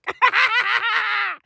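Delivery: high, long cackling burst; sustained shrieking laughter; manic laugh. Acted. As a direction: cackle wildly at a high pitch. Bursts out after shouted question.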